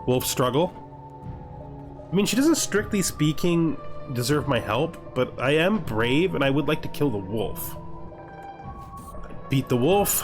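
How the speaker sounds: ordinary delivery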